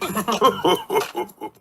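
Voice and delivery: put-on high-pitched child giggle. A narrator's reading. Character laughs.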